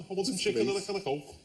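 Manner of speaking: a light Scouse accent, lightly put on. Shown as a demonstration. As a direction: say it in a playful, hint-of-Scouse accent. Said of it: "Can you do the next, like, five minutes in a Scouse voice".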